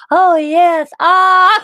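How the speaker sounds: high, loud mock swoon over the accent; dreamy shout at the French-accented dentist; hammed-up ah. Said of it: "hammed-up dentist moan".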